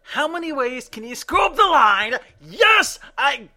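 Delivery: high, loud exasperated yell; high-pitched fed-up cry; frustrated outburst. Real, not acted. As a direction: blurt it out exasperated, pitch shooting up. Frustrated outburst at fluffed line.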